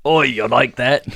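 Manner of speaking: slow, put-on Aussie taunt; mock Australian brawler. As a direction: echo a cartoon Aussie fighter, drawn out. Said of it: "And he's like".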